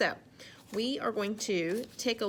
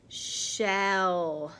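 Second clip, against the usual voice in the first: ordinary delivery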